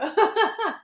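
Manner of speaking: high peal of laughter; amused laugh. Real, not acted. Teacher tickled by student's comment, amused laugh. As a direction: laugh happily, pitched high. Joking about student on a roll.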